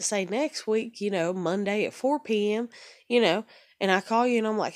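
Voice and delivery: ordinary talk, nothing marked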